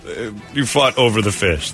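ordinary talk, nothing marked

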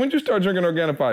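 surprised. Retold or imitated. Quoting his own surprised question.

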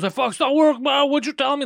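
high, loud, panicky whine; mock a flustered friend blaming you; imitated panic. Host mimicking his friend failing at the live stream, high mock panic. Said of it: "He starts panicking".

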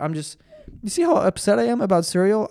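ordinary delivery

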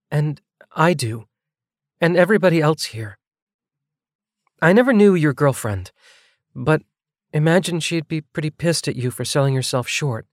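earnest, lightly voiced woman. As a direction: make the point gently but firmly. Nevaeh continues her quiet reply.

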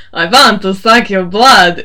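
loud, slow cheesy vampire; bad vampire impression. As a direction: ham up a bad Dracula. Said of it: "his best vampire impression".

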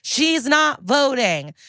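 high-pitched exasperated scolding, words stretched out; mock yell. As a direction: imitate an outraged yell without much volume. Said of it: "Barba has to yell".

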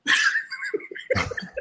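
high unvoiced burst of laughter; laughing. Real, not acted. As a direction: cackle at your own joke. Laughs at his own joke.

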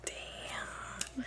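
a breathy whispered damn. Real, not acted. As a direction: breathe it out softly. Listener reacts to story.

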